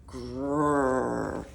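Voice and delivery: a mock growl from the lion. Lion growling at the tiny mouse in its paw. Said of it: "growled the lion".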